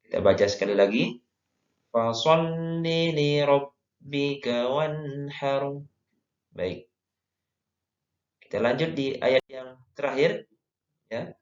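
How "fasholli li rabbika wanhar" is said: slow, measured Quranic recitation. Shown as a demonstration. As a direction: recite slowly, stretching the held sounds for learners to follow. Recites the verse again. (mengaji tartil, pelan)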